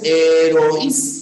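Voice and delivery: "heroísmo" slowly as he-ro-ÍS-mo, I stressed; stress on closed vowel. Shown as a demonstration. Teacher contrasting two words for class, stressed Í in "heroísmo". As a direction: punch the Í of "heroísmo", split from the O. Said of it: "La fuerza de voz está en la cerrada".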